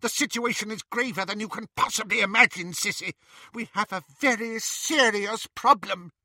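high, fast urgent witch. Acted. Bellatrix warning Narcissa, high fast urgent. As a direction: rush the warning feverishly.

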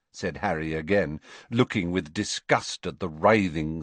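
usual speaking voice

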